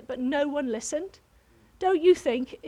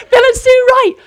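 much higher and louder, rushed and breathless; retold excited greeting, pitched way up; frantic excitement; 11 semitones higher, 12 dB louder than usual. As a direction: blurt it all out at them like a mad woman. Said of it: "I jumped on them like a mad woman".

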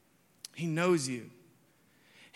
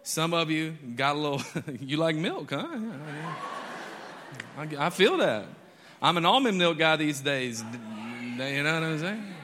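laughing; 3 semitones higher, 3 dB louder than usual. Real, not acted. Joking about milk, laughing.

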